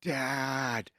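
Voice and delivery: mock kid whine; imitated whiny kid. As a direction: whine it like a kid calling a parent. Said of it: "he did it in a little whiny voice".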